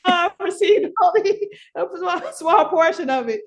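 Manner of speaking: high, laughing denial; laughing protest. Retold or imitated. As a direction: brush it off through laughter. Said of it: "I'm like".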